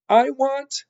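put-on high, loud girlish wail; crying out. A narrator's reading. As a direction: cry it out as a desperate serving girl. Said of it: "cried Mig".

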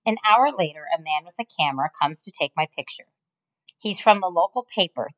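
ordinary delivery, nothing marked

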